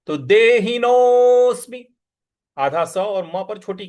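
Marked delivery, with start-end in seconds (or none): 0.2-2.0 देहिनोऽस्मि with the नो stretched long and loud; long vowel. Shown as a demonstration. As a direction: draw out the नो in देहिनोऽस्मि. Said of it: "इसको दीर्घ खींचना है".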